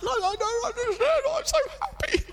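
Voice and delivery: very high, fast, overjoyed protest; a boy too elated to sleep, pitched right up; retold joy. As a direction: gush it out, bursting with happiness. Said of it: "He said".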